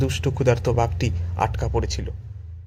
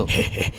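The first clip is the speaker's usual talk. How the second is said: short husky villain's chuckle; sly beastly little laugh; low-voiced tiger snicker; tiger's chuckle. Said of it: "একটু হেসে বলল".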